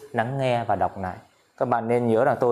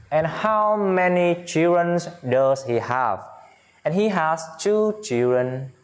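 slow, higher, careful teacher reading; slow model reading; 4 semitones higher, slower than usual. Shown as a demonstration. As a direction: read each sentence slowly for pupils to repeat. (giáo viên đọc mẫu chậm, cao giọng)

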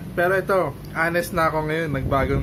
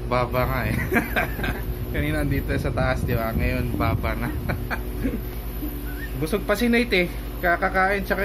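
muffled behind cloth covering; laughing through a face mask. Real, not acted. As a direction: voice through fabric gaiter. Seen 0: Cloth gaiter covers mouth and nose while laughing; R frames unmasked.

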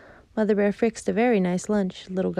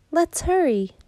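high, louder eager cub; small bear cub, high and excited; eager little cub; 11 semitones higher, 5 dB louder, slower than usual. Acted. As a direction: hurry her along like an impatient cub. Little Bear urges Mother Bear.

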